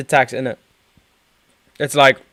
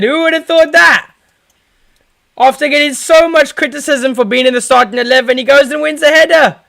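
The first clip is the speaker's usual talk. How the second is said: loud, high-pitched goal celebration; ecstatic goal shout; 10 semitones higher, 7 dB louder than usual. Real, not acted. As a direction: yell it like a fan whose team just scored. Fan shouting as his team scores.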